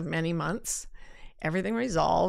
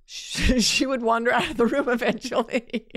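talking through a laugh, higher and louder; 5 semitones higher, 5 dB louder than usual. Real, not acted. Speaker catching her own slip about her friend, laughing. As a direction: giggle through the words.

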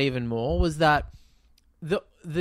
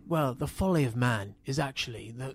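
lightly put-on upper-class English soliloquy; mock posh English; 3 semitones lower, 5 dB quieter than usual. Podcaster parodying the mute character's final monologue, posh English voice. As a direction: imitate a posh philosopher, low-key and measured. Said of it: "he's doing it in posh voice".

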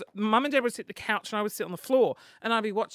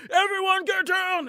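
loud, high, drawn-out command; gunman's shout; 12 semitones higher, 5 dB louder, slower than usual. Acted. Gunman walking into the hospital, acted shouted order. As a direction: yell it across a packed room.

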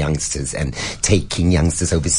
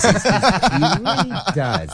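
much higher, very slow; mock drawn-out voice; 12 semitones higher, much slower than usual. S0 teasing about Brian, slow high mock voice. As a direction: stretch it out playfully.

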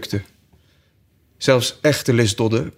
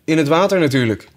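higher, louder sheep voice; matter-of-fact sheep; 6 semitones higher, 3 dB louder, faster than usual. Acted. Sheep answers Alice, high and smug. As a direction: state the obvious like a smug sheep. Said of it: "zei het schaap".

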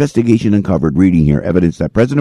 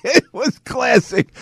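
high, slower words through laughter; laughing; 13 semitones higher, 4 dB quieter, slower than usual. Real, not acted. Host winding down over the clip, still laughing. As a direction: savour it through the giggles.

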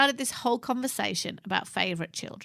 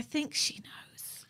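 whisper; 5 semitones higher, 12 dB quieter, much slower than usual. Real, not acted. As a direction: lean in and whisper it slowly. Hushing it from small ears.

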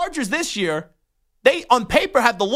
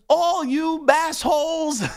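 higher, slow, shouted insult; angry shout; 6 semitones higher, slower than usual. Retold or imitated. A furious Jets fan yelling at Patriots fans. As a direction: bellow it at the whole fan base. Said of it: "And he goes".

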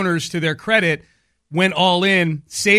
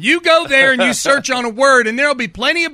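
put-on high-pitched lecturing old-timer; hammed-up old geezer explaining the library; old man impression; 7 semitones higher than usual. Mocking the coach's age with library talk, old man impression. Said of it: "How old does he sound".

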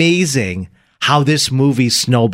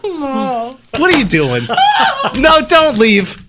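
high, drawn-out cry through laughter; laughing protest; 12 semitones higher, slower than usual. Acted. Calling to a departing scene partner, laughing and high. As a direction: call after them, cracking up.

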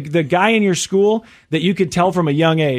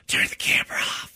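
voiceless hushed plea; whisper; 7 dB quieter than usual. Retold or imitated. Host imitates the chief's plea to the cop, whispered. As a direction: whisper it so nobody hears. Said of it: "He goes".

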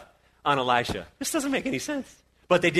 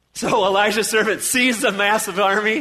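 ordinary delivery

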